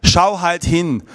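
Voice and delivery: slow, loud, insisting; grandma urging the boy to look; insistent grandma. Retold or imitated. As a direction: press it gently but firmly. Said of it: "energisch geworden".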